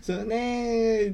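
high, very slow drawn-out agreement; imitating the auntie. Auntie replying to the muttered jab, high and slow. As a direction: stretch it out like the auntie. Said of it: "って言った後に".

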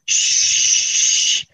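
long aerosol "shhh"; spray it out in one sustained hiss; imitated spray hiss. Coach sprays repellent against the fear, long imitated spray hiss.

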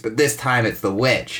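usual speaking voice

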